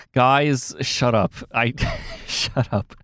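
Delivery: mock-annoyed, breaking into a laugh; laughing protest. Real, not acted. Streamer to teasing chat after an awkward admission, laughing protest. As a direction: tell them off while starting to giggle.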